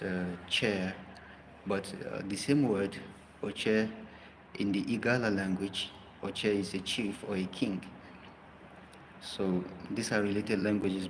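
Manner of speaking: ordinary delivery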